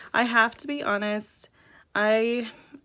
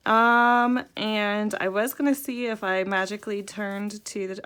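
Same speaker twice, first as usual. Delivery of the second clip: normal talking voice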